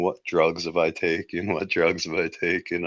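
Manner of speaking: ordinary talk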